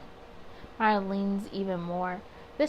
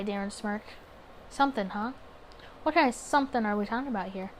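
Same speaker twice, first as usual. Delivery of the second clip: usual speaking voice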